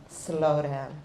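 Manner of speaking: ordinary delivery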